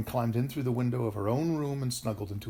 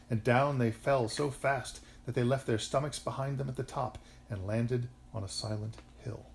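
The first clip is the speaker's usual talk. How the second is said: plain reading voice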